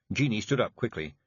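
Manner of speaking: plain reading voice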